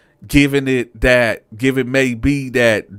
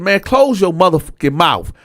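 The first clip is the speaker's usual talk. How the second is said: raised, higher snapping retort at the cellmate; angry retort; 8 semitones higher, 5 dB louder than usual. Retold or imitated. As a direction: tell him off, hot and loud. Retold jail quarrel with cellmate.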